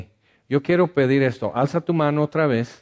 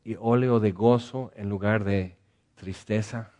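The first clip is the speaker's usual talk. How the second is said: earnest pastoral prayer. Acted. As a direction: pray gently over the sad. Leads prayer for the sad.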